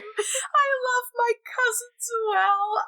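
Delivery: high, slow, hammed-up declaration; playful mock declaration. Quoting her joke about sharing, playful high declaration. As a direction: draw it out high and teasing.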